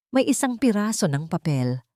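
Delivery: usual speaking voice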